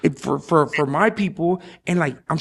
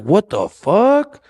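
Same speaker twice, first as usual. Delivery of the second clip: slow mock shock; 4 semitones higher, much slower than usual. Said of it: "it's like".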